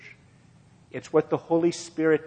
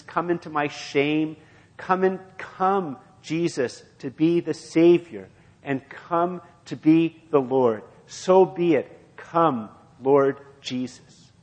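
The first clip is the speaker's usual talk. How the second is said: slow, louder and higher cry of prayer; rising fervent prayer; 3 semitones higher, 4 dB louder than usual. Acted. Preacher prays aloud before the church, slow swelling plea. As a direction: build the plea to a crescendo.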